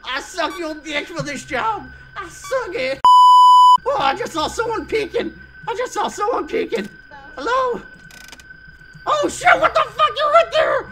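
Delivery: frantic high-pitched panic; panicked. Real, not acted. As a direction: squeal in real terror.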